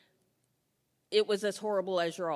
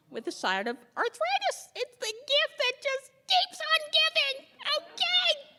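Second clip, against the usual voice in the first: very high, louder sarcastic sing-song; 5 dB louder than usual. Acted. Joking to the audience about side effects, a very high sarcastic gush. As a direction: gush it with biting irony.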